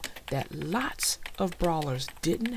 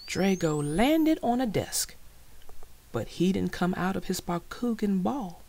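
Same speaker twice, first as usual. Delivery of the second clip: ordinary delivery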